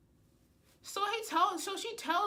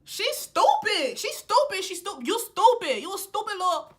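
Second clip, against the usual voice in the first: high, loud exasperated ranting; furious at her friend, loud; real anger; 7 semitones higher, 9 dB louder than usual. Real, not acted. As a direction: let the anger boil over. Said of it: "I'm getting angry".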